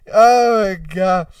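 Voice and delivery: usual speaking voice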